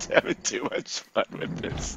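words squeezed out through laughing; voiceless, laughing speech; helpless laughter. Real, not acted. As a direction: wheeze it out mid-laugh. Talking while laughing hard.

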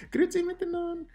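very high, quick put-on voice; jokey high-pitched German hello; squeaky German greeting. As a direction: chirp it in playful falsetto. Playful German greeting after accent joke.

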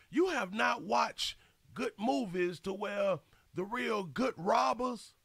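ordinary talk, nothing marked

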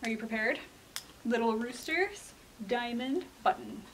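ordinary delivery, nothing marked

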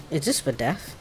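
ordinary talk, nothing marked

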